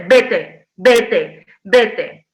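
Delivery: loud, firm chorus of vete; loud chorus sending someone away; chorus shouting. Acted. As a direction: hammer out each vete like a chorus. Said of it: "hay un coro que te dirá".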